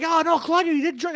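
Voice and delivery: high-pitched scandalised imitation; mock shock. Everyone scolds Claudia over drugs, in exaggerated shock. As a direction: mimic everyone being appalled, pitched well up.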